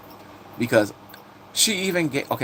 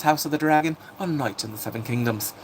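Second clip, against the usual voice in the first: ordinary talk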